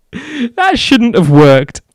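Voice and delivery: high, loud, slow laughing; laughing disbelief. Real, not acted. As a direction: say it through laughter. Disbelief after trick.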